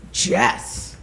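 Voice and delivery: slow, flat reveal from the friend; deadpan friend. Retold or imitated. Friend telling her it was the same driver, slow deadpan reveal. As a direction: drop the news slowly. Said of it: "she goes".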